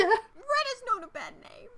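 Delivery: high, slow put-on British lady; high falsetto British accent; mock British accent. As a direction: play a prim English girl, high-pitched. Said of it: "gave my commentary in an accent as well".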